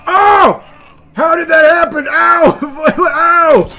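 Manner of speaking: loud high yelps of pain; pained shouting. Pretend. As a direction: cry out as if hurt. Character wounded, shouting pain.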